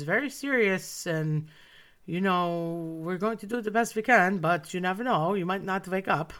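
grave German doctor, slightly lifted pitch; German accent. Retold or imitated. German doctor warning a child before surgery, grave German accent. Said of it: "very thick German accent".

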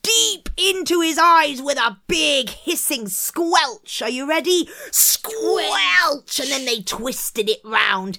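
high, loud, thrilling storytelling; excited narration. A narrator's reading. Narrator telling how the stake hits the eye, excited and loud. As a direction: build to the gory moment with relish.

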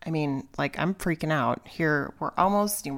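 normal talking voice

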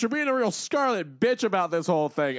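mocking taunt. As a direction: needle her with a loud, high sneer. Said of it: "mocking Wanda".

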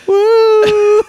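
high laughing mock whoop; shrieking jackpot winner. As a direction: shriek a lame woo while laughing. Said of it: "really lame woo noises".

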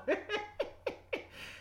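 high, soft, trailing giggles; breathy laughter. Real, not acted. Preacher amused at anyone wanting prophecy, trailing breathy laugh. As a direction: let the laugh run on breathlessly.

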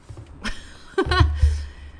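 breathy giggle; a real airy laugh; high airy laugh. Real, not acted. Laughs at the essay.

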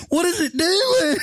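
high falsetto bewilderment; mock-panicked mom, very high voice; mom's startled squeal. Imitating mom as the box starts playing music, startled squeal. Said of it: "and she went".

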